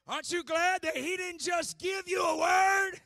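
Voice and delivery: fiery sermon shouting; preaching. Acted. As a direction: preach it with full force. Preaching at full force.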